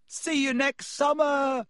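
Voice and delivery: high, slow, gleeful yell; mocking farewell shouted out a car window. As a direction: call out back at the house in triumph. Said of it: "Harry yelled".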